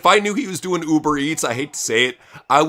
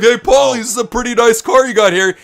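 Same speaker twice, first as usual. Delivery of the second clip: mock fan; 8 semitones higher, 7 dB louder than usual. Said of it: "And he's like".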